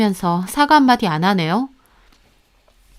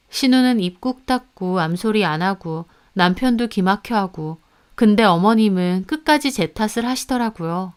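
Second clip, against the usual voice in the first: ordinary talk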